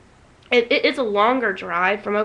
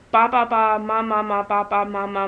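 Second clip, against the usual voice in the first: put-on baby babble. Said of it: "she'll go".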